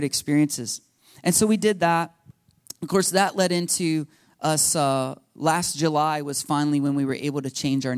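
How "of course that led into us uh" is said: ordinary talk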